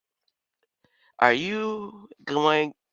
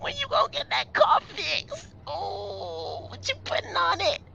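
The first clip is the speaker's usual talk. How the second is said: mocking high voice. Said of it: "Be like".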